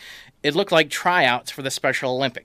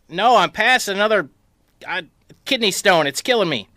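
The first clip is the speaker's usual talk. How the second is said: dad in pain; 6 semitones higher than usual. Retold or imitated. Dad in kidney stone pain.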